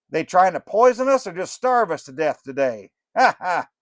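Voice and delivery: a burned-out rock star calling out; aging rocker. Acted. Rocker greeting the fudge makers, loud and high. Rocker joking about food.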